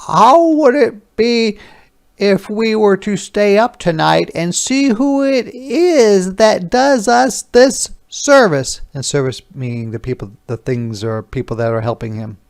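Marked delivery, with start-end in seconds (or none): 0.1-8.8 high, slow storybook shoemaker voice. Acted. Shoemaker asking his wife to stay up, high slow wondering voice. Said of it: "he turned to his wife before he went to bed and said".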